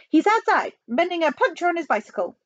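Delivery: put-on high Chinese-accented excuse; high, innocent phone voice; Chinese assistant premier. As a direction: pitch it well up as a caricatured official.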